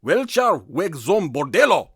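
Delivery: put-on slow, loud German accent; loud attempt at a German accent; best German accent. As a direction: read it slowly in a German accent. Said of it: "so this is in my best German".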